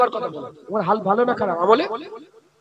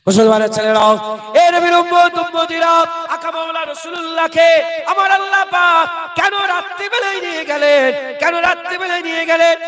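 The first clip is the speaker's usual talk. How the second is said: loud, high sermon cry; fervent Bengali waz shout; full-force preaching; 6 semitones higher, 3 dB louder than usual. Acted. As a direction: preach it out to the whole crowd.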